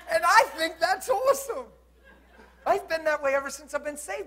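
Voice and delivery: high, loud, impassioned preaching; forceful preaching. Real, not acted. Preacher defending his straight preaching to the congregation, forceful delivery. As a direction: press every point on the congregation.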